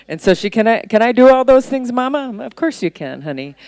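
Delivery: normal talking voice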